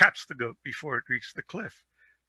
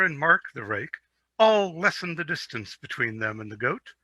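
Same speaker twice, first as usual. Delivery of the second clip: ordinary delivery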